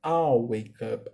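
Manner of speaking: "I'll" said slowly as one syllable; I'll pronunciation. Shown as a demonstration. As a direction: stretch "I'll" out for the class. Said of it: "A pronúncia do pronome I mais o will é I'll".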